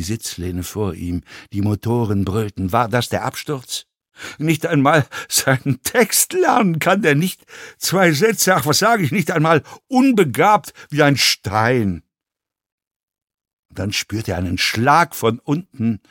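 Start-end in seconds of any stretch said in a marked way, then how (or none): 4.4-12.0 heated, swooping contemptuous tirade; ranting fellow passenger. A narrator's reading. Passenger mocks actor's failure at lines, heated rant. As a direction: rail at him, tumbling from insult to insult.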